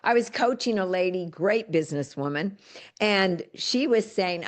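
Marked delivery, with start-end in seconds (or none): none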